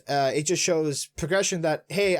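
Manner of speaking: ordinary talk, nothing marked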